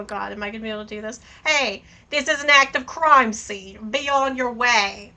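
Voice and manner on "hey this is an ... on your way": put-on slow, pushy Brooklyn cop accent; thick Brooklyn accent. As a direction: bark it like a Brooklyn street cop. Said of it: "yells in a thick Brooklyn accent".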